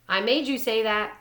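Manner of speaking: ordinary delivery, nothing marked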